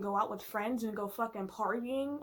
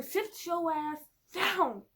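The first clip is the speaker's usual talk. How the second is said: mock scolding; 7 semitones higher, 4 dB louder, much slower than usual. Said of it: "tell Caesar".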